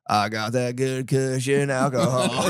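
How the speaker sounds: put-on slow, laughing drunk-guy voice; drunk slur. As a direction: play a happy drunk slurring his boast. Drunk man talking to cops.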